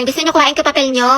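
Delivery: usual speaking voice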